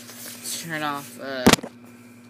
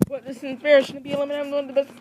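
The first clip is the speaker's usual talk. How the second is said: much higher and very fast, huffy; indignant squeak; 10 semitones higher, 4 dB louder, much faster than usual. Acted. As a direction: sputter out the outrage at being eliminated. Eliminated character complains.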